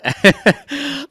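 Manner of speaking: short high laugh; a loud burst of laughter mid-sentence. Real, not acted. Host laughs before addressing the elephant in the room, short laugh.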